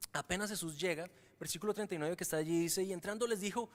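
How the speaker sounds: ordinary talk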